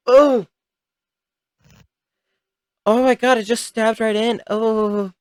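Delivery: high, loud shocked yelp; shocked squeal. Real, not acted. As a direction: squeal in horror at the stab. Recoiling at stabbing incision.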